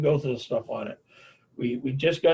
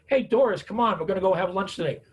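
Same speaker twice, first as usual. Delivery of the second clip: higher, upbeat invitation; friendly coaxing; 5 semitones higher than usual. Acted. Friend urging Doris out to lunch, upbeat coaxing. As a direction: call out brightly to coax a friend along.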